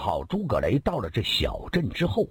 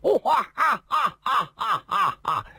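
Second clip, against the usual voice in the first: evil laugh; 11 semitones higher than usual. Acted. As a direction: throw back a roaring laugh. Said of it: "诸葛雷举杯大笑".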